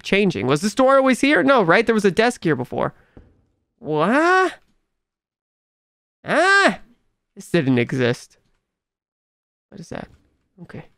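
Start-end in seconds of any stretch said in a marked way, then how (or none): 6.2-6.8 startled yelp. Real, not acted. Startled by a changed room.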